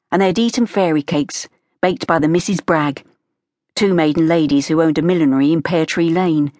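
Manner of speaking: ordinary delivery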